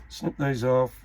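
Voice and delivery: ordinary talk, nothing marked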